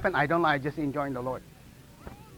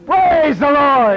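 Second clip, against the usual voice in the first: loud, higher, drawn-out shout of praise; exultant praise; 6 semitones higher, 7 dB louder, much slower than usual. Retold or imitated. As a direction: stretch it out and proclaim it joyfully. Preacher re-enacts calling on Lord.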